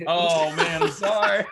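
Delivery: laughing, high and loud; laughing apology. Real, not acted. Reacts to friend falling for scam.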